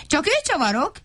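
high yelp without real volume; small character's half-hearted yell; mock shouting. A narrator's reading. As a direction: cry out high in protest. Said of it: "kiabált Vacska Matti".

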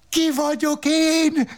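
very high, slow howled question; mock howl. Roberto howling at his wife, very high and slow. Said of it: "Üvöltötte".

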